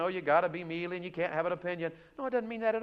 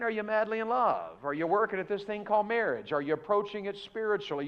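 usual speaking voice

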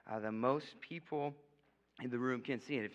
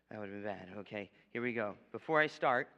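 ordinary talk, nothing marked

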